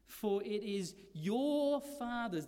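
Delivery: slowed, "your" drawn out and hit; stress on your. Shown as a demonstration. Preacher showing the emphasis in the verse, "your" stressed. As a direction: lean on "your" in "your Father's". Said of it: "is an emphatic there".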